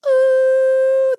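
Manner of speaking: high, loud squealing whale call; whale ooh. Acted. Boobs as beluga whales, loud high ooh. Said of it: "They're like".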